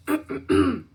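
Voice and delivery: loud throat clear. Real, not acted. Clears throat after breathlessness.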